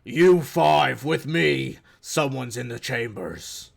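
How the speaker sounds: slow, loud booming command; booming giant voice. Acted. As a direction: bellow it like a giant calling his troops. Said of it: "You hear another booming voice".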